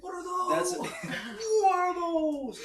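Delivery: squeaky, laughing falsetto; mock the question in falsetto; high mocking voice.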